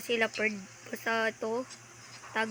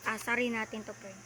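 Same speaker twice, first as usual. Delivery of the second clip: usual speaking voice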